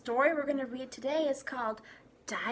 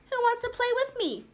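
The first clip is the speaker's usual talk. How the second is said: put-on high, fast child voice calling friends; a bossy girl rallying her playmates; eager little girl; 12 semitones higher, 4 dB louder, faster than usual.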